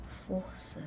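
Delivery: barely voiced, very soft disbelief; incredulous inner thought. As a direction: think it aloud in a near-whisper. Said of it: "pensei assim".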